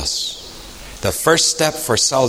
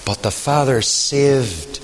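slow emphasis; 4 dB quieter, slower than usual. Acted. Preacher repeats key line, fading.

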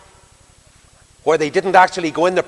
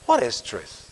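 soft, musing question; understated wistfulness; almost wistful; 3 semitones lower, 4 dB quieter than usual. Acted. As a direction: ask it quietly, as if to yourself. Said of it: "almost wistfully".

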